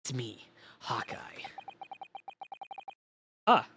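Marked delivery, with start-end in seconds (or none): 0.0-1.2 gruff whispered Solid Snake growl; low secret-agent rasp, barely voiced. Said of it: "was that a good snake voice".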